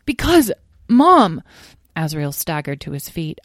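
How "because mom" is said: high, loud, drawn-out whine; exasperated young dragon. Acted. Azrael objecting to his mother's suggestion, exasperated. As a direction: stretch it out in protest to Mom.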